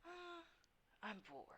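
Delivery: a soft, bored shadow murmur; dark whisper behind you. Acted. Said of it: "it's the Shadow's voice".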